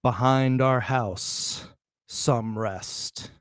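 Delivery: stretched, very slow reading of "Behind our house". Shown as a demonstration. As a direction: linger on every word of "Behind our house, some rest". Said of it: "read twice, first drawn out".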